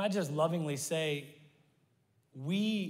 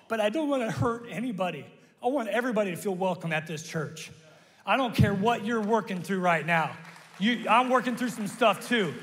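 louder, driving sermon climax; preaching at full force; 3 semitones higher, 5 dB louder than usual. Real, not acted. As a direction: build to a passionate close.

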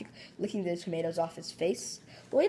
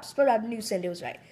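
ordinary delivery

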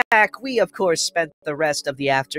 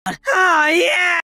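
high, loud mock cry of lust; aroused, excited outburst; 12 semitones higher, 5 dB louder than usual. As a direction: blurt it out, half cheer, half moan. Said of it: "sounded like something between an excited outburst and an aroused moan".

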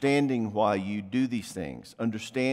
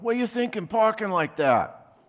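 imitating a snapping spouse, higher and louder; mock angry jerk; 10 semitones higher, 4 dB louder than usual. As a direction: play the rude spouse barging in. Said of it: "They come in the house going".

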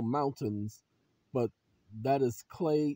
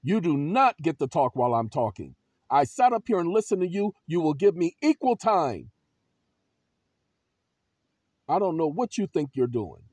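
loud, emphatic stand against interruption; forceful objection; 3 semitones higher, 9 dB louder than usual. Shown as a demonstration. Answering a judge who cuts you off, loud forceful objection. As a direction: cut back in and demand your turn. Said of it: "you say".

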